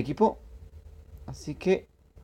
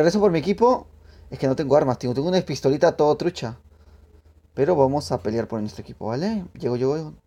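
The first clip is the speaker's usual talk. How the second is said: usual speaking voice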